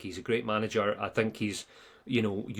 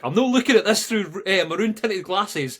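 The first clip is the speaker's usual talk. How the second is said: higher, louder mock pundit voice, slowing down; 8 semitones higher, 6 dB louder than usual. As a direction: send up the pundit's protest. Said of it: "John Robertson's like".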